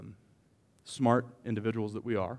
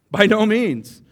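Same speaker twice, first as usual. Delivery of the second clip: higher, louder karate-style cry; karate shout; 8 semitones higher, 12 dB louder, slower than usual. Shown as a demonstration. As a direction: bark it out like a martial artist. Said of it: "It's like a, like a karate".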